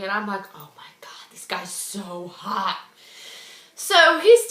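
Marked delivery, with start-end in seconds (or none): 0.6-2.8 hushed swooning aside. Retold or imitated. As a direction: sigh it quietly to yourself, smitten. Swooning inner thought in story.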